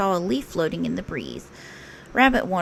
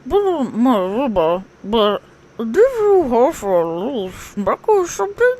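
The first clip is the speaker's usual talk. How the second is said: slow, higher, mumbling through stuffed cheeks; mouth stuffed; 4 semitones higher, 4 dB louder, slower than usual. Acted. Pooh asking Rabbit with apples in his cheeks, muffled. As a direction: talk as if your cheeks are full of apples. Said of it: "It sounded something like".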